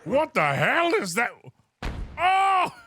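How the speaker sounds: startled. Acted. Captain startled by the demon-like reply, shocked. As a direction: jump at the weird voice.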